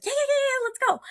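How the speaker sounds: bubbling over with yes before the boss finishes; overeager yes. Retold or imitated.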